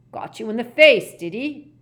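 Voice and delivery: loud, high, goading; taunting old man. Acted. Gaunt mocking the injured official, taunting. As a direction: belligerent character voice, needling.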